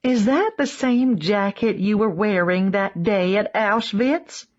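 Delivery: put-on chatty Southern American, broad accent; Southern tourist asking her friend, oblivious. A narrator's reading. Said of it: "in a broad southern accent".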